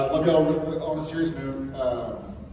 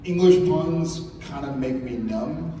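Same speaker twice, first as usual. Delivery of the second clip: ordinary talk, nothing marked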